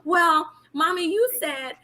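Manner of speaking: put-on a kid catching mom out; imitating a smart-mouthed son. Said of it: "He's gonna be like".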